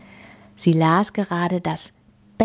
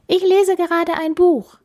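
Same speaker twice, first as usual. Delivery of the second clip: put-on high, loud girl's protest; calling out; 12 semitones higher, 5 dB louder than usual. A narrator's reading. Bella busy reading, rebuffing the king, calling out. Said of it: "rief Bella".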